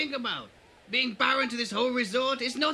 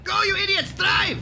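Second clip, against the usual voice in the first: frantic shout; 5 semitones higher than usual. Acted. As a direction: bark it at the driver. Urging driver to flee.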